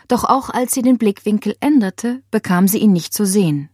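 plain reading voice

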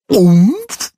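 beak hit by cake grunt; high muffled grunt; muffled umpf. A narrator's reading. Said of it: "macht der Schnabel".

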